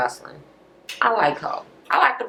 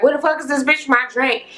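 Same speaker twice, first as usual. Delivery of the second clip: acting crazy; 6 semitones higher than usual. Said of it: "Acting crazy as hell".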